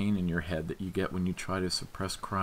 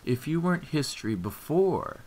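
sneered; 8 semitones higher than usual. A narrator's reading.

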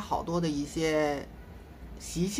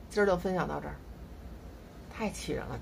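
ordinary talk

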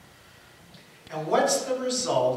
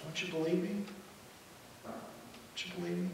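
soft wounded question, fading; acted quiet hurt, dropping to near-whisper; 11 dB quieter than usual. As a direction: ask it wounded, barely voiced the second time. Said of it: "Peter, we're told, is hurt".

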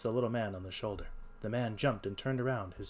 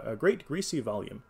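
plain reading voice

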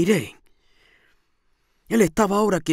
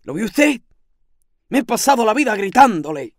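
raised, higher voice; exasperated; 4 semitones higher, 5 dB louder than usual. A narrator's reading. Landlady complaining to her listener, exasperated. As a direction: exclaim it in mild exasperation.

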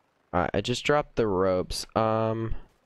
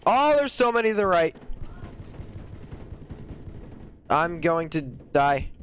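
panicked; 7 semitones higher than usual. Real, not acted. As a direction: wail that you're doomed. Overwhelmed by enemies.

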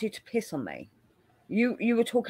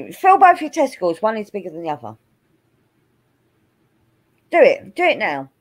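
loud, insistent demand; 7 dB louder than usual. Real, not acted. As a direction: insist loudly, no arguing. Demanding testicle check.

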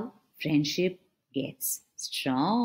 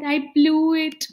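crying; 9 semitones higher, 7 dB louder than usual. Acted. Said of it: "And he's crying".

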